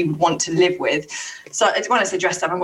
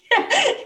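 high burst of laughter. Real, not acted. Laughing at muddled story number.

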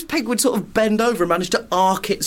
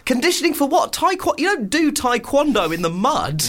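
high, exasperated disbelief; baffled, high-voiced protest; incredulous; 8 semitones higher than usual. Real, not acted. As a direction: challenge the absurd claim, pitched up high. Co-host incredulous at barefoot training claim.

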